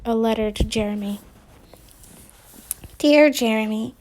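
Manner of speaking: ordinary delivery, nothing marked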